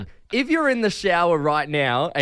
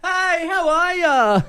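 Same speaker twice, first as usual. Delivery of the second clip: cheery greeting; slower than usual. Retold or imitated. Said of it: "someone behind me go".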